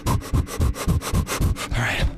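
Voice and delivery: breathy whispered all right; nervous whisper. Real, not acted. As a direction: whisper it to steady yourself. Psyching up at the edge.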